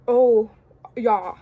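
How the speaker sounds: low, lightly attempted Australian voice; attempted Australian accent. Idol replying to an admirer, attempted Australian accent. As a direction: try a rough Australian accent. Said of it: "like, an Australian accent".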